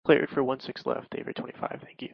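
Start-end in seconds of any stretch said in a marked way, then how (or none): none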